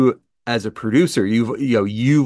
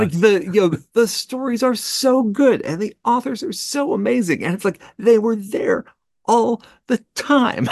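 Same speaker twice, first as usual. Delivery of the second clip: delighted; 12 semitones higher than usual. Real, not acted. Gushing about new authors.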